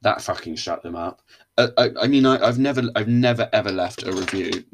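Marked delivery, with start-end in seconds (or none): 1.6-4.6 emphatic outburst. Real, not acted. Emphatic denial, loud burst.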